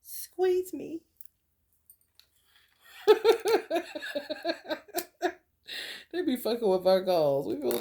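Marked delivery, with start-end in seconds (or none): 0.0-1.0 very high, prim squeeze me. Pretend. 3.0-5.3 loud high laughter; laughing. Real, not acted. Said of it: "laughs at own joke".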